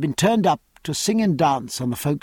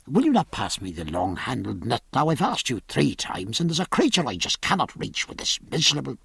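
impatient; 4 semitones lower, 5 dB quieter, faster than usual. A narrator's reading. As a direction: lower and softer, rushed irritation. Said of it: "in a rather impatient tone".

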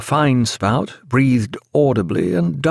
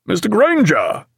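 high call across the deck; 10 semitones higher than usual. A narrator's reading. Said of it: "called Jack".

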